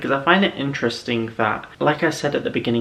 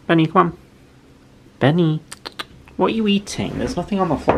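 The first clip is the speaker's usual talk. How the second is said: high raised calling voice; calling a pet; 7 semitones higher, 4 dB louder than usual. Real, not acted. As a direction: call the pet over, high.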